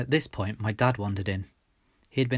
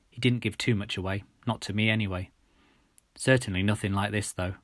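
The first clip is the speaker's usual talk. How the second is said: ordinary delivery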